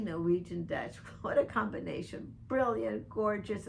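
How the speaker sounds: ordinary delivery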